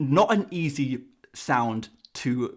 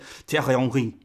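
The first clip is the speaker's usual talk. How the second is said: lower, throaty French r's in THIERRY HENRY; French accent; 4 semitones lower than usual. Acted. YouTuber imagining someone switching to French mid-sentence, mock French accent. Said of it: "let's think of a French player".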